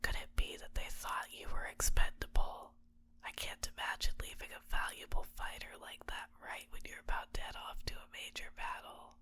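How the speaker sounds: fully whispered, soft and close; intimate whisper. Acted. Captor to captive about the friends who left them, whispering. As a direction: whisper it as a private speculation.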